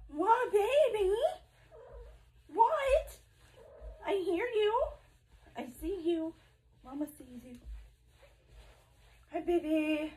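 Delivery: very high sweet pet talk. Real, not acted. High baby talk to the cat.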